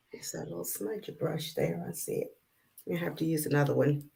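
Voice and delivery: very soft talking to oneself; muttered aside. Real, not acted. Presenter spotting the smudger brush, muttering to herself. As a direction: murmur it under your breath, off to the side.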